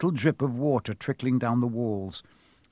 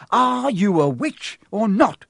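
higher, louder, slow; bellowing; 8 semitones higher, 5 dB louder, slower than usual. Acted. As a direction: roar the challenge at her. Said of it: "Ron bellowed".

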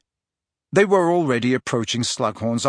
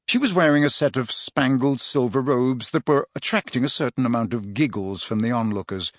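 usual speaking voice

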